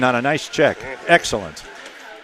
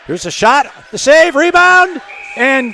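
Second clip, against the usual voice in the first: rising to a loud, high shout; excited shot call; 11 semitones higher, 8 dB louder than usual. Real, not acted. Announcer calling a shot, save and rebound, excited. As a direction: build urgently through the scoring chance.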